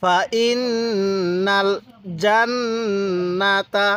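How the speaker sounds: slow chant, long nasal nn in ইন্নাল and জান্নাতা; sustained, level ghunnah on ইন্নাল; joined recitation. Shown as a demonstration. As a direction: hum each doubled n in জান্নাতা. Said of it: "আরেকবার".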